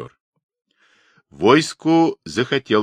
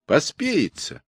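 high-pitched, only lightly raised grumble; old man shouting; 6 semitones higher than usual. A narrator's reading. Old man mocking the order to write, mild cry. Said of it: "крикнул с середины согнувшийся старик".

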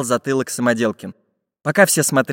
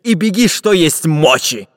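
pirate's order; 3 semitones higher, 4 dB louder than usual. A narrator's reading. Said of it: "приказал пират Буль-Буль".

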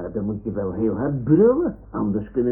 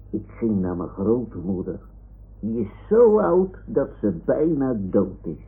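plain reading voice

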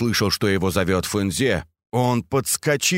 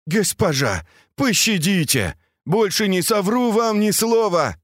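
high-pitched plea for mercy; pleading; 9 semitones higher than usual. A narrator's reading. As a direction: beg the mistress, pitch climbing. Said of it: "взмолился".

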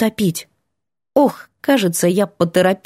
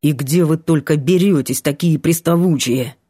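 lower, weary grumble; annoyed young man; 5 semitones lower than usual. A narrator's reading. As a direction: grumble at pushy girls. Vanya's voice.

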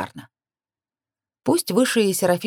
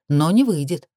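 plain reading voice

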